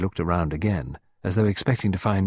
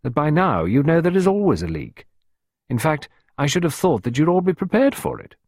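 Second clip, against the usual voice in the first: tartly; 8 semitones higher, 5 dB louder than usual. A narrator's reading. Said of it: "Clarissa observed tartly".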